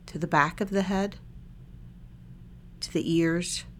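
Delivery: calm body-scan guidance with long silences between phrases; unhurried, even meditation-guide voice; guided meditation. Real, not acted. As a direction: lead listeners gently through a meditation, leaving lots of space.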